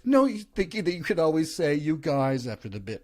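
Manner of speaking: put-on impressionist voice; rough writers'-room character voice; shitty secondhand impression. Shown as a demonstration. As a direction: badly imitate a comedian doing his characters. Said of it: "shitty impression of all Kyle's impressions".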